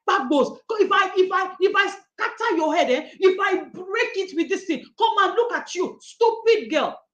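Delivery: a hint of an angry mother scolding; mock angry parent. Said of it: "Are you like".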